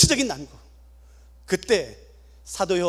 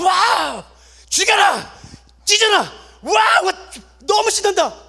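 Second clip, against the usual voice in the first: high, loud roaring cheers; arena crowd screaming for blood, shouting high and loud; bloodthirsty crowd shout; 9 semitones higher, 4 dB louder, much slower than usual. Acted. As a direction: roar like a frenzied arena crowd.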